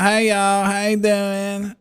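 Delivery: high, drawn-out Southern drawl; Southern accent. Retold or imitated. Son imitates mom's accent on request, high Southern drawl. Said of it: "can you do her accent".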